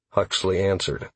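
plain reading voice

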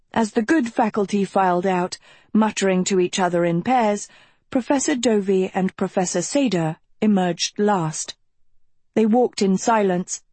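ordinary delivery

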